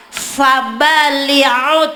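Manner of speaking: Fabaliut drawn out very slowly in a mock Arabic chant. Preacher jokes about how Arabs talk, mock Arabic drawl. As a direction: stretch Fabaliut like pretend Arabic.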